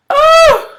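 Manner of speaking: gasp, then a very high loud squeal; hammed-up gasp and shriek; mock shock. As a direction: act out a scandalized falsetto reaction. Acting out a shocked reaction.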